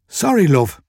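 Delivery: wheezy shopkeeper complaining; burly Irish shopkeeper. A narrator's reading. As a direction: grumble as a put-upon Irish shop owner.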